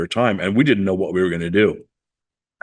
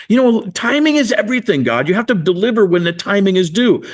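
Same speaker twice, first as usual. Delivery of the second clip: much higher, scolding lecture; indignant lecture to God; 10 semitones higher, 3 dB louder than usual. Retold or imitated. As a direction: wag a finger at God with the voice. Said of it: "I said this to him".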